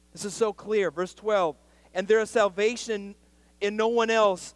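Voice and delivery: loud, high-pitched pulpit preaching; fiery preaching. Acted. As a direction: drive the point home to the church. Preaching scripture at full force.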